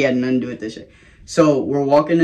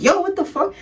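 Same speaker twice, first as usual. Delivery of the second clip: startled outburst. Retold or imitated. Waking to find girl in face.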